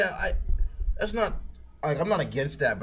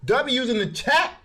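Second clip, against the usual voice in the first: excited; 11 semitones higher, slower than usual. Real, not acted. Hype to chat.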